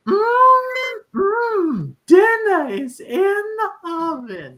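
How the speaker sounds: very high, loud, slow whiny monster voice; Evil Ed voice. Retold or imitated. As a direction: croon it like a creepy undead mama's boy. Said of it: "he's like the".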